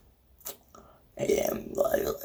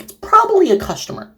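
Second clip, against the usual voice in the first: high, sing-song mock innocence; mock naive. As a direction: play the clueless one, pitched up. Mocking the character's naivety.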